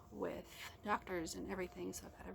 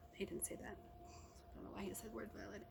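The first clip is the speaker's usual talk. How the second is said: breathy, much softer aside to herself; whispered aside; 9 dB quieter than usual. Real, not acted. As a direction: drop to a near-whisper for the correction. Whispered aside correcting her quote.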